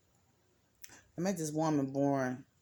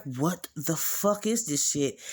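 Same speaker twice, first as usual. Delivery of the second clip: shocked retelling. Retold or imitated. Retells her shocked reaction.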